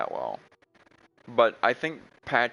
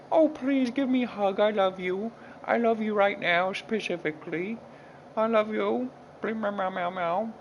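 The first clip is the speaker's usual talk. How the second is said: high, sweet kitty voice begging for a hug; much higher, pleading meowing kitty; cooing cat voice; 10 semitones higher than usual. Pretend. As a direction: coo like a cat asking for love. Owner voices cat asking hugs.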